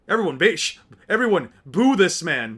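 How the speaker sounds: loud mock rallying cry; hammed-up heckling call; mock call to boo. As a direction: rouse the crowd against him.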